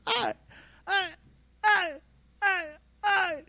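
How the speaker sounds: high yelping ay cries of pain. Retold or imitated. Being pulled back by ropes, high pained yelps. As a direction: yelp each ay like being yanked.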